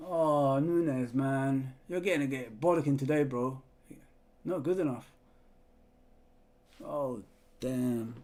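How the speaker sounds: low, deflated grumbling after a miss; real fan's letdown; dejected disappointment. Real, not acted. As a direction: let it sink, low and let down. Disappointed after the missed chance.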